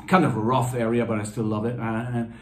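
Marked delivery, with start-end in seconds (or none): none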